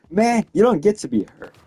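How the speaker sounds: acted indignant protest; indignant telling-off. Imagined telling-off of hurt admirer.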